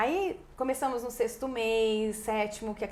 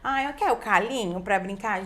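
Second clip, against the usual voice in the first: louder, exaggerated talking-to-baby voice; mock baby talk; 5 dB louder than usual. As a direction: babyish mispronounced speech in a swooping tune. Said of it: "E não é falar errado".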